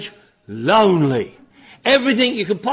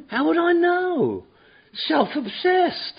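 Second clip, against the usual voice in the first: high, slow, mocking disbelief; sarcastic put-on voice; mock incredulous; 10 semitones higher, slower than usual. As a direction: scoff at the word like it's absurd. Mocking the book's word.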